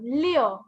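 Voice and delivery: "Liu" rounded into "lyoh"; wo sound. Shown as a demonstration. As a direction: finish "Liu" on an open wo sound. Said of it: "kind of like a wo sound".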